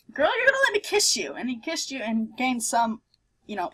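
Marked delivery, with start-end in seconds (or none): 0.1-1.3 much higher, eager quote; high excited quote. Retold or imitated. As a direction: squeak it out, excited. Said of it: "ran up to you and said".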